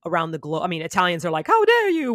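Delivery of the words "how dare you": put-on outraged Italian accent, much higher and louder; hammed-up offended Italian, Luigi style; mock Italian accent. Italians offended about pizza, hammed-up Italian accent outrage. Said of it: "I can't do my Italian Luigi accent".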